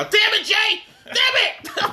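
real frustration at a forgotten name; frustrated outburst. Real, not acted. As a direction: yell at yourself for blanking.

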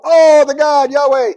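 a loud, high, awed exclamation; amazed crowd cry. Retold or imitated. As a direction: cry out in sudden astonishment as the crowd. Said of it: "people go".

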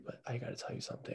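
whispered, soft and quick; mock secret whisper. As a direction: lean in and whisper it like a confession. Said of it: "just say".

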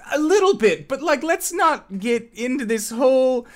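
loud, high defensive blurt; flustered manager, brushing off. Acted. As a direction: wave off the question in a high fluster. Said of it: "as he's kind of quickly talking".

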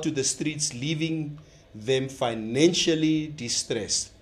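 reading an official press statement; solemn, deliberate statement delivery; formal statement reading. Acted.

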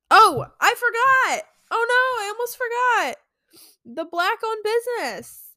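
high, loud oh no moment; sudden realization. Real, not acted. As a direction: gasp as you remember. Suddenly remembers forgotten plug.

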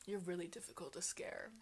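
imitated low whisper; hushed admission. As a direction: drop to a whisper, playing him backing down. Retelling his subdued admission.

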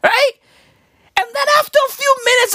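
ordinary talk, nothing marked

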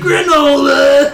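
high, long drawn-out yell; goofy hammed-up battle cry; Rad Chad catchphrase. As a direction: holler it way up high, stretched out.

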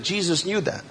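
usual speaking voice

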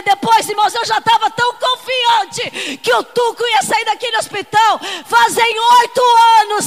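loud, fervent preaching cry; full-force preaching. Acted. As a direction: preach it to the congregation with fire.

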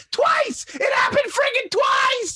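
very high, loud, incredulous yell; mock screaming. Friend told it happened twice today, mock screaming. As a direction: imitate a hysterical friend screaming. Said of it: "He's screaming and yelling".

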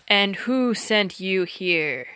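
slow giant interrogation. Acted. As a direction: weigh each word like a giant. Giant questions Robin.

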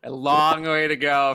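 "long" held and stretched out; drawn-out LONG. Real, not acted. Podcaster repeating how far they must move up, stretched "long". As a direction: linger on "long" to show the distance.

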